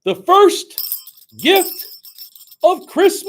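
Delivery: big high ringing proclamation; calling out. Acted. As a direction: announce it to the whole crowd of elves. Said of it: "called out".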